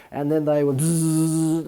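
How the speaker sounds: a slow, drawn-out buzzing zzz; imitated vacuum hum. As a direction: hum it like a vacuum cleaner sucking up dirt. Imitates vacuum cleaner sucking dirt.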